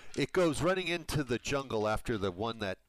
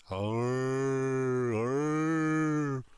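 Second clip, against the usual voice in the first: zombie groan; 4 semitones higher, 6 dB louder than usual. Acted. GM voicing zombies attacking.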